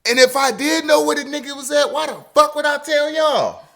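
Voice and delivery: high, loud mimic of the girl yelling at cops; shrill-free but high, hammed-up retort; mock girl yelling. Narrator mimics the girl yelling at the police, high and loud.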